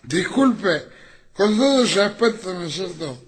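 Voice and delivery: put-on high, wobbly drunk apologizing; drunk apology. Drunk man apologizing to the woman, high wobbly voice. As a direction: plead politely while plastered.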